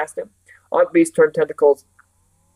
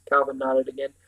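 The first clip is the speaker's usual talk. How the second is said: ordinary delivery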